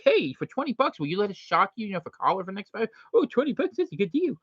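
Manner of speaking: high, naive voice jumping at easy money; squeaky, eager sucker taking a cheap deal; mock eager lab volunteer. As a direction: play a gullible guy thrilled by a small payout. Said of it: "You go in there and be like".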